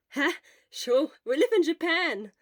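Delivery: loud, quick, eager friend; loud, urgent friend's voice; Hisashi, loud. Acted. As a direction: push it out fast and loud at the sick friend.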